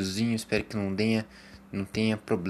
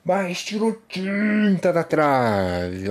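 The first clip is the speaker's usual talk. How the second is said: football commentator; 7 semitones higher, slower than usual. Acted. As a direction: scream the near miss like a live sportscaster. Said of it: "colocar uma emoção nisso". (narrador de futebol gritando o lance)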